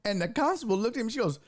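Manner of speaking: usual speaking voice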